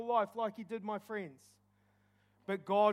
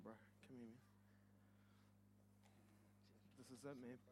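much softer, close murmured words of welcome; murmured encouragement; 9 semitones lower, 19 dB quieter, much faster than usual. Real, not acted. Pastor quietly welcoming those who come forward, murmuring. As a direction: gently welcome each person at the altar.

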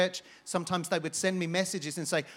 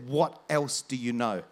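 low, slow, weighted question; lowered voice, drawing out each word; retold intense demand; 5 semitones lower, slower than usual. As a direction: imitate a desperate man, held in and deliberate. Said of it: "he was nearly full of tears".

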